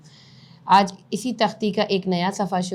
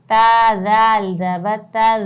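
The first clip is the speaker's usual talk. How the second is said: loud, slow letter-by-letter spelling; spelling drill; 2 semitones higher, 7 dB louder, much slower than usual. Shown as a demonstration. Teacher spells the word aloud for the class, slow and loud. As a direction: call out each letter and vowel for students to repeat.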